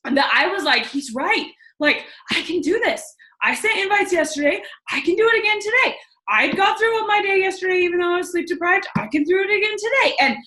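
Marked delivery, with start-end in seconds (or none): 0.9-10.2 high, building pep talk to herself; fired-up self-talk. Retold or imitated. Woman convincing herself she can repeat yesterday, fired-up self-talk. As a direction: psych yourself up, pitch climbing well up.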